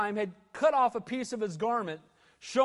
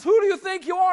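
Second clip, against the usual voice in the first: much higher, much louder outraged yell; indignant shout; 12 semitones higher, 9 dB louder, faster than usual. Retold or imitated. Abner shouting back at David across the hill, outraged yell. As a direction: bellow it like an affronted general. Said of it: "Abner says".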